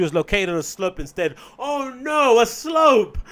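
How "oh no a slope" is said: loud, high, hammed-up wail; mock horror. Comic mocking pensioner placed on slope, mock horror. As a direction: wail it as if disaster struck.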